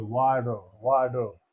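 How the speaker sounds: American accent. Shown as a demonstration. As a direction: repeat "वाटर" with an American d for t. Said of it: "टी को डा का".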